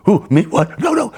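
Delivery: high, panicky stammered denial; Barney Fife. Retold or imitated. Scared boy denying it to the biker, panicky falsetto. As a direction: squeak out a comic cowardly protest. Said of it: "felt like Barney Fife from Andy Griffith's show".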